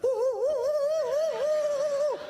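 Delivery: very high, slow, squealing ooh; mock scared squeal. Husband fleeing his wife with a high squeal. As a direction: squeal like a frightened man fleeing.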